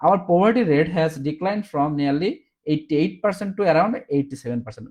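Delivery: very slow, word by word, as if dictating; slow dictation. Shown as a demonstration. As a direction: read it out slowly so they can write it. Reading out written answer. (ধীরে ধীরে ডিকটেশনের মতো পড়া)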